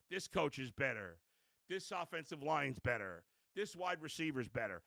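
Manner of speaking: softly imitated list of excuses; excuse-making apologists. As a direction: reel off excuses quietly, as the apologists would. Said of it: "the apologists are gonna make every excuse".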